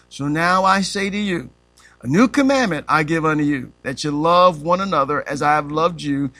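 ordinary talk, nothing marked

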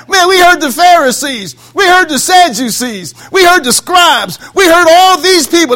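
high, loud exclamation; excited protest. Acted. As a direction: hammer out each example as if amazed. Officers' excited report, acted.

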